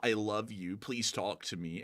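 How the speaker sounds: a light needy whimper; mock pleading YouTuber. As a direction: beg it quietly like a lonely guy. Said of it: "He'll be like".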